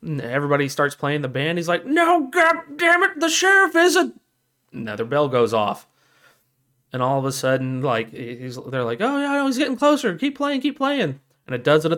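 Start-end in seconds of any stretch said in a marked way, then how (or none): 1.9-4.1 frustrated shout. Retold or imitated. Lookout trying to correct the townsfolk, frustrated shout. 9.0-11.2 high-pitched mock townsfolk voice; excited urging. Townsfolk telling the band to continue, excited urging.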